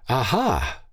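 mild triumphant aha; exclaiming. Acted. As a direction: pounce on the find with delight. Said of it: "she exclaimed".

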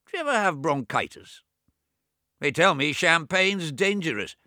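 put-on high, loud, peevish old man; sick brother snapping at his deaf twin; testy old Swithin. A narrator's reading. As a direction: scold him like a cranky invalid. Swithin complains and boasts.

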